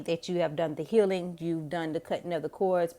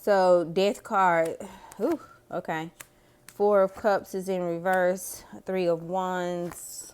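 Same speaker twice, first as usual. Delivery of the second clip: slow, drawn-out card reading; slow card reveal; slower than usual. Real, not acted. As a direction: read each card slowly, savoring it. Slowly drawing tarot cards.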